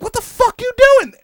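high, loud incredulous yell; high loud mock disbelief; retold yell. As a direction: shout it at your friend. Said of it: "they're like".

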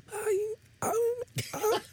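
squeaky, strained, airless oh; mocking a friend who can't talk; imitating lost voice. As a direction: croak it out with no voice left. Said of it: "how you fucking sounded".

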